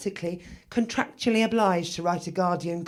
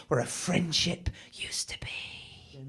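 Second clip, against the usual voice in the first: hushed whisper; 4 semitones lower, 9 dB quieter, slower than usual. Acted. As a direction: drop to a dramatic whisper. Dramatic hushed punchline.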